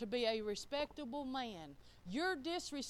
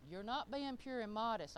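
ordinary talk

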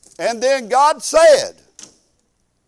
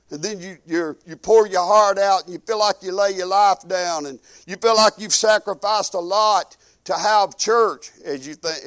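ordinary delivery

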